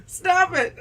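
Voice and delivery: high shrieked no; hammed-up scream at an eel; mock panic no. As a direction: cry out in play terror.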